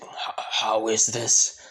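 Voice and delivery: slow, whispery stammer of disbelief; shocked villain. Acted. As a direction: stammer it slowly in a stunned whisper. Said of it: "Beto still being shocked".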